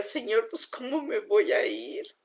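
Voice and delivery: slow, pained plea to God; retold crying prayer. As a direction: plead as if through tears, slowly. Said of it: "Yo lloraba y decía". (súplica dolida y lenta, como llorando)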